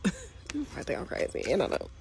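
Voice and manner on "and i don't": usual speaking voice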